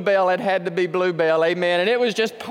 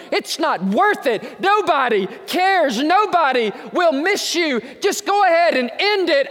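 raised, urgent voice imitating jeering devils; shouting devils; 6 semitones higher, 4 dB louder than usual. Devils of hell speaking to the despairing, shouted. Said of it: "And they're shouting".

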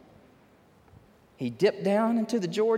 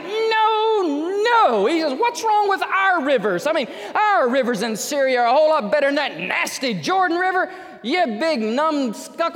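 higher and louder, hammed-up indignant refusal; mock angry general; 7 semitones higher, 5 dB louder than usual. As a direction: play Naaman furious and insulted. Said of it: "man, he was mad".

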